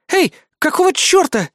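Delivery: high, indignant outburst. A narrator's reading. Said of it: "возмутилась Синтия". (возмущённо, на высокой ноте)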